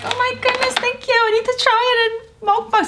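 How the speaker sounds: squealing high and delighted; high excited voice. Retold or imitated. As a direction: gush in a high happy squeal. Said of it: "And I was like".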